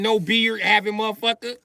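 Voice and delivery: ordinary talk, nothing marked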